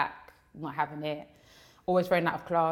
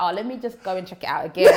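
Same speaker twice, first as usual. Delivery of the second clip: louder giggly quote ending in laugh; 2 semitones higher, 8 dB louder than usual. Real, not acted. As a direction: giggle through the words. Laughing at her own motive.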